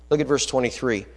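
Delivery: ordinary talk, nothing marked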